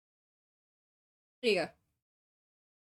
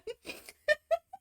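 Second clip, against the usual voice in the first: high laugh. Real, not acted. Laughing about Kai.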